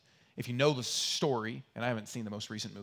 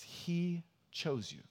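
gentle quoting of Paul; 5 semitones higher, 4 dB quieter, much slower than usual. Acted. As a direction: say it gently, letting it land. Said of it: "He says".

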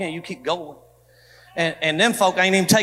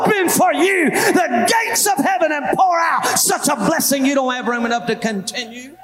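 shouted scripture promise, a touch higher; preaching at full force; 6 semitones higher, 3 dB louder than usual. Acted. Pastor proclaiming scripture to the church, full preaching shout.

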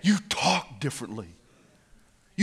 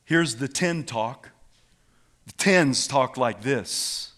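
ordinary talk, nothing marked

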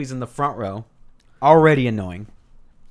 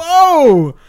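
loud, high shocked whoa; stunned whoa; 11 dB louder than usual. Retold or imitated. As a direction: blurt it out in shock. Stunned reaction to the outburst.

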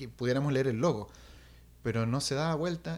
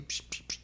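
quiet, voiceless psh psh psh; soft hissed splash sounds; splashing noises; 11 dB quieter than usual. Retold or imitated. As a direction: imitate little feet splashing in water. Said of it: "chapoteo en el agua".

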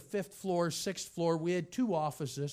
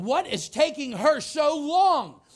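impatient thought; 8 semitones higher, 9 dB louder, slower than usual. Retold or imitated. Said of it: "and I'm thinking".

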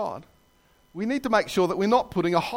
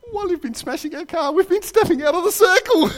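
very high squeal, building louder; gleeful culprits; 13 semitones higher, 5 dB louder than usual. Retold or imitated. Two boys confessing their trick to the cop, gleeful squeal. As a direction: pipe up high and crow the punchline. Giggling boys deliver the punchline.

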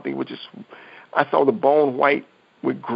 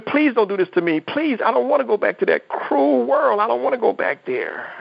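retold screaming plea; 7 semitones higher than usual. Man begging God not to send him back, high mild mock scream. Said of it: "was screaming".

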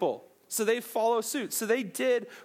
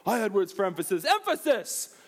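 Josh impression. Repeating the TV character's catchphrase for laughs, hammed-up impression. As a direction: rush the line, then squeal out the last word. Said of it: "When Josh was still fat".

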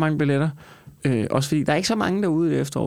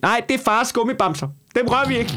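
strict parent; 8 semitones higher than usual. Retold or imitated. Parent voiced warning about candy.

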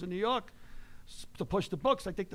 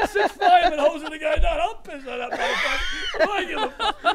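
laughing shout; 7 semitones higher, 5 dB louder than usual. Real, not acted.

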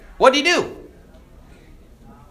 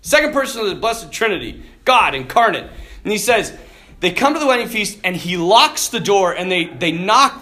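normal talking voice

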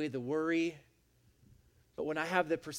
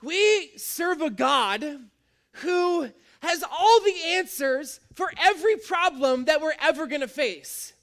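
loud, high, driving sermon voice; full-force preaching; 11 semitones higher, 9 dB louder than usual. Acted. Preacher proclaiming to the congregation, full force. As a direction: preach it out big and bold to the room.

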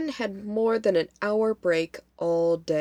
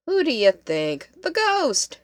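exasperated retort, leaping higher at the end; 6 semitones higher, 3 dB louder than usual. A narrator's reading. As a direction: answer as if it's obvious. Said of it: "Kate rolled her eyes".

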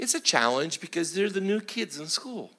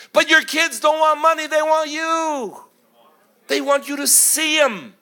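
high, loud preacher's shout; impassioned exhortation; 11 semitones higher, 6 dB louder than usual. Real, not acted. Preacher urging hard-working dads to see their kids, impassioned shout. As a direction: drive it home, pitched way up.

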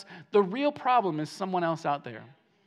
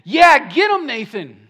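loud, high acted-out cheer; worked-up burst that drops on the name; egging him on; 3 semitones higher, 12 dB louder than usual. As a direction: cheer on the preacher, hammed-up. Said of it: "he's thinking".

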